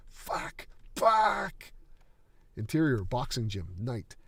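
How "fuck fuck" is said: anguished rage scream. Acted. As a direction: whisper then a much higher, louder scream. Ren raging in the alley.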